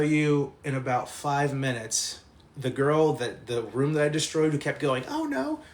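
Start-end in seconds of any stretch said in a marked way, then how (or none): 5.1-5.6 put-on small, very high-pitched girl's cry; mock wail. Storyteller quoting the girl's catchphrase, high mock wail. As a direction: imitate her squeal without the volume.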